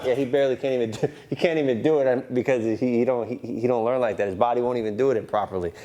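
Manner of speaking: ordinary delivery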